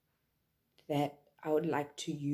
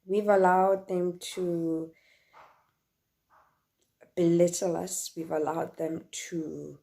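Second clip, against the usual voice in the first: impassioned; 6 dB louder than usual. Real, not acted. Impassioned outburst about men.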